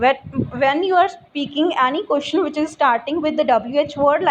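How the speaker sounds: ordinary delivery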